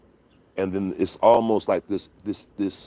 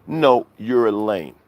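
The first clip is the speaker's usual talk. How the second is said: ordinary talk, nothing marked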